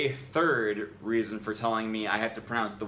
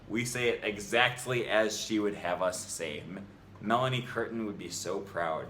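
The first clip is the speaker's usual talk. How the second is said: ordinary delivery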